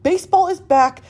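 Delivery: bright upbeat sponsor read. Acted. Telling listeners about the ticket app, upbeat ad read. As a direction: sell it like a host plugging a sponsor.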